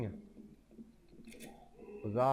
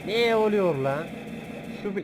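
jolted by a sudden scare; long shocked yell; startled shout; 6 semitones higher, 8 dB louder than usual. Real, not acted. Startled by sudden game event.

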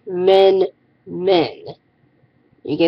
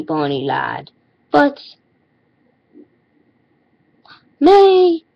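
a lightly hammed-up Irish accent, blessing turning higher; mock Irish accent; 5 semitones higher than usual. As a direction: playfully put on a mock Irish brogue, rising into a grand blessing. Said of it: "terrible Irish accent".

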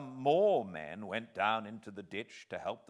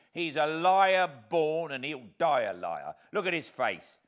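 fierce; 4 semitones higher, 7 dB louder than usual. Acted. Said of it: "with fierce energy".

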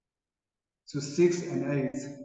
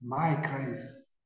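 soft, reassuring words, much softer; tender hush; 4 semitones lower, 9 dB quieter than usual. Retold or imitated. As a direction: comfort them gently, almost in a hush. Repeating Jesus' words softly.

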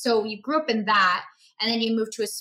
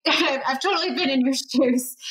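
laughing; 4 semitones higher than usual. Real, not acted. Laughing at shared experience.

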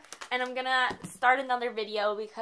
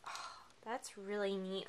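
whisper; 3 semitones lower, 9 dB quieter than usual. Real, not acted. Admiring beads quietly.

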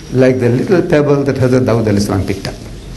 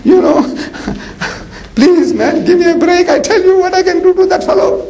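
high, pleading rush of youthful eagerness; eager pleading boy. Retold or imitated. Shepherd boy begs the commander to let him fight, eager. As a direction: beg the commander, voice climbing higher. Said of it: "He says".

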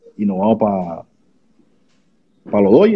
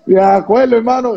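excited; 11 semitones higher than usual. Real, not acted. Excited reaction to big news.

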